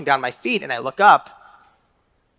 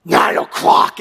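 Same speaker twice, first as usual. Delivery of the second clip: gruff answer; slower than usual. Retold or imitated. Old man answers from car.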